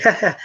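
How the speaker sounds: high mock cackle; a hint of an old man's creepy laugh; imitated laugh. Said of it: "he's like, he's like".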